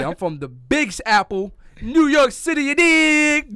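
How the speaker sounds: loud, high hype shout; hype New York brag. Pretend. Hyping up New York roots.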